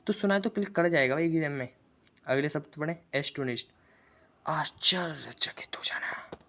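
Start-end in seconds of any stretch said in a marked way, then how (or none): 4.5-6.4 slow, breathless whisper of amazement; astonished whisper. Shown as a demonstration. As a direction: whisper it slowly as if stunned. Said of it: "क्या बोला मैंने?".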